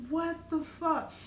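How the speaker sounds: slow, high, drawn-out disbelief; stunned disbelief. Retold or imitated. Spotting serial-number marks on her thigh, slow stunned disbelief. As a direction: let it hang slowly, unable to believe it. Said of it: "I was like".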